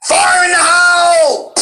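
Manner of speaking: very high, drawn-out battle yell; bombastic Junkrat. Acted. Guest playing the bomber blowing things up, manic yell. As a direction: holler it high as the bomb goes off. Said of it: "while he's, like, blowing shit up".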